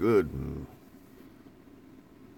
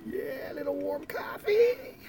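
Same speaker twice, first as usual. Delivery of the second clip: mock squeaky coffee delight; slower than usual. Savoring the coffee.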